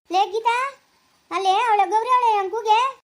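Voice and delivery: high, loud, slow and rude; rude call. Acted. Told to call Gauri, Ambuji yells rudely, high and loud. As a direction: bark the name out grudgingly. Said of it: "ಹಂಗೆಲ್ಲ ಹೇಳಂಗಿಲ್ಲ".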